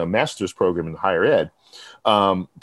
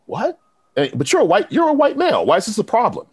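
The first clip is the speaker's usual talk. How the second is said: high-pitched disbelieving squeak, retold; voicing his own stunned reaction, pitched way up; incredulous what; 12 semitones higher than usual. Recalling hearing the accent story, incredulous high voice.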